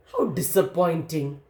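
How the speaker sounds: plain reading voice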